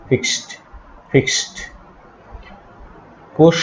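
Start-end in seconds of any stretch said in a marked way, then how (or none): 0.1-1.5 "ফিক্সড" said as "fikst"; final -ed as T. Shown as a demonstration. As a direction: finish "ফিক্সড" on a crisp voiceless t. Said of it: "ট এর মতো উচ্চারণ হবে". ("ফিক্সড"-এর শেষে ট উচ্চারণ)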